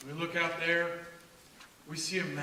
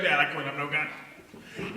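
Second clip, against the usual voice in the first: tearful quitting; 4 semitones lower, slower than usual. Retold or imitated. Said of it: "she's like".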